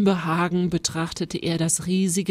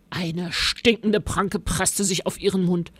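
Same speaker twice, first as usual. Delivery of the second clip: usual speaking voice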